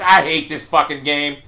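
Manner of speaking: ordinary delivery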